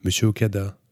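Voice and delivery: calling out. A narrator's reading. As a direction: call down to someone far below. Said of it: "appelait-elle".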